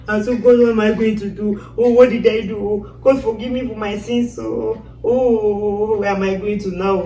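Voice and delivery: mock-weeping plea to God; crying lament. Woman retelling her plea to God, crying lament. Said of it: "That's how I'm crying".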